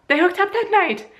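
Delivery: shocked squeal. Pretend. Repeating to viewers the sister's betrayal, high shocked squeal. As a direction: shriek "They hooked up that night" in disbelief.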